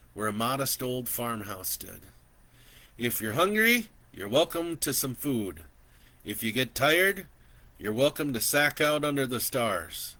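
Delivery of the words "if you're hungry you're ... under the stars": put-on higher, generous old rancher; old man inviting a traveler to stay; kindly old man. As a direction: offer food and a bed warmly as an old man. Old man's offer.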